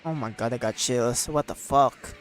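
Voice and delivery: ordinary delivery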